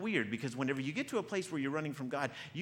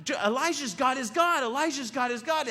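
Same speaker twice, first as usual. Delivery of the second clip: loud, high repeated chant; crowd shouting; 8 semitones higher, 6 dB louder than usual. Acted. The people after God sends fire, shouted chant. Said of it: "they start shouting".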